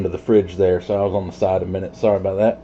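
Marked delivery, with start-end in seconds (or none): none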